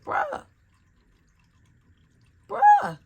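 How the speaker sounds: very high squeaked one-word reaction; high-pitched, incredulous squeak; exasperated disbelief. Real, not acted. Exasperated reaction to coworker's contradiction.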